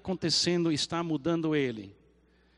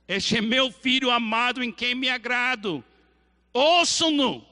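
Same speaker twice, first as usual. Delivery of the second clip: loud and higher, commanding; voice of God; 5 semitones higher, 5 dB louder than usual. Acted. Preacher voicing God speaking from the cloud, loud. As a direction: announce it like a voice from the clouds. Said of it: "agora eu vou usar a voz de Deus".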